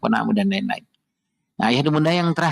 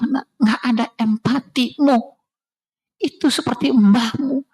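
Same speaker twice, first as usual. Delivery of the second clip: higher, slow, stern rebuke; scolding son; 8 semitones higher, slower than usual. Retold or imitated. Father scolding son for lacking empathy, slow stern rebuke. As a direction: lay it on him slowly, pointedly.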